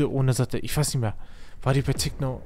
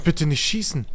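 usual speaking voice

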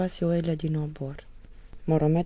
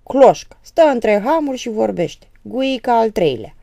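loud, scornful jab; wife scorning her husband, loud and contemptuous; 7 semitones higher, 12 dB louder than usual. Acted. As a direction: spit out the insult with disdain. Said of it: "adăugă cu dispreț".

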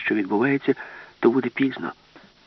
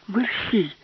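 sharp, much higher hurry-up call; urgent cry; 13 semitones higher than usual. Acted. Alice hurries the professor, sharp high urgent cry. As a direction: snap it high to hurry him. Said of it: "крикнула Аліса".

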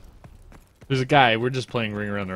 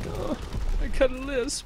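whiny. Retold or imitated. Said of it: "I was like".

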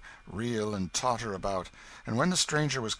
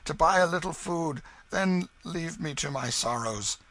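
higher, piteous beggar's plea; feigned suffering; 6 semitones higher, 5 dB louder than usual. A narrator's reading. Hugo begging the stranger for a penny, faking agony. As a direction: plead as if racked with pain. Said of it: "Hugo began to moan and groan".

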